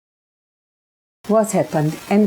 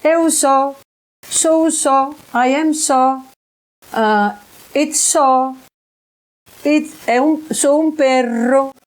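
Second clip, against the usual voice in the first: lightly raised, hinting at a strange small voice; quoting the voice's words a little higher; imitating the spirit voice; 3 semitones higher than usual. Speaker recalls the dog spirit's voice, lightly mimicked. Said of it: "that used to say".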